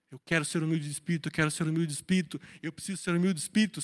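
fast, low monotone repetition; obsessive self-talk. Acted. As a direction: rattle it off like a fixated mantra. Obsessive self-talk, acted as repeated chant.

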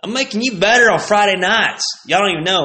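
high, loud smug teacher; teacher justifying failing grades, high and smug. Retold or imitated. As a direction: lord it over the class. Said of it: "he'd be like".

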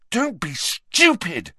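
slow, high, forceful scolding; urgent reproof. Acted. Harry pushing Ron against the wall, urgently scolding. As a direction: rebuke him hard, drawing it out.

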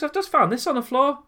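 high, loud mock mum voice; imitating mum holding up a pill; mum's voice. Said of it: "She goes".

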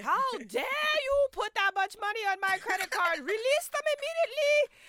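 high, loud scolding mom voice; angry mom. Retold or imitated. Imagined mom scolding over the credit card, outraged. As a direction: rise into an outraged mother's demand. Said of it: "Susie's mom was just like".

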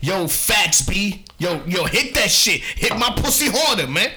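high, loud mock city girl; exaggerated, pushy street talk; girls talking like dudes. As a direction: hype it up like a brash street hustler.

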